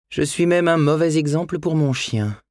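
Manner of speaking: voix de femme légèrement jouée, plus aiguë; pensée intérieure. A narrator's reading. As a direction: se reprocher à soi-même, un peu lasse. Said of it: "songea-t-elle". (monologue intérieur d'une mère lasse)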